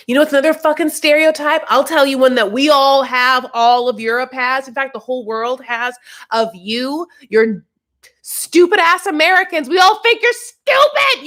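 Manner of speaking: real outrage building to a near shout; angry rant. Real, not acted. Ranting at the show's characters.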